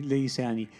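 ordinary delivery

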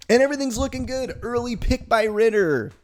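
rising excitement, fast and loud; excited. Real, not acted. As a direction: hype it up like the game is going well. Building excitement about game.

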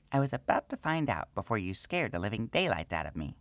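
high gnome voice with a sheepish grin. Acted. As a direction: own up a little embarrassed. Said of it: "with a sheepish grin".

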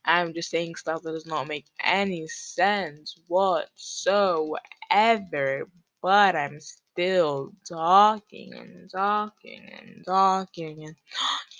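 slow, stretched-out, singsong rambling; drawn-out rambling. Pretend. Podcaster filling time with nothing to say, rambling slowly. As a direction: drag out every repeated word as you ramble. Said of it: "I'm doing it again".

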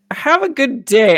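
fake-cheerful exit line to escape; retold overly polite goodbye; mock polite brush-off. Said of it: "And I was like".

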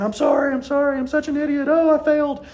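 high, pained boy's voice, mock despair; upset boy apologizing. Lewis wailing at himself after the invention explodes. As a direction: play Lewis whimpering sorry after a flop. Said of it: "he starts saying".

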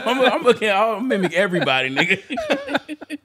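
high laughing tease. Real, not acted. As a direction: laugh while teasing. Laughing threat to mimic.

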